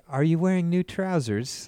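put-on British accent. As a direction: imitate a girlfriend's British-learned English. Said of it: "learned from an English speaker".

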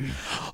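sharp hopeful gasp; mock gasp; imitated gasp. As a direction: gasp with a new idea.

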